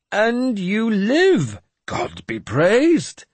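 very high, loud, very slow praise; overjoyed holy fool; mock rapture. Jeremias rejoices.

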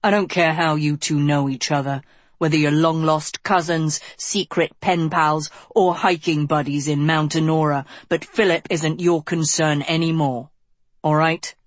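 plain reading voice

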